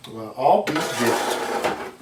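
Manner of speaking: ordinary talk, nothing marked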